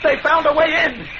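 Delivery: high, panicked shout; alarmed cry. Acted. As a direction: cry out the danger. Alarm at rats breaking in.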